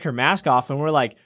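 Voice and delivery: usual speaking voice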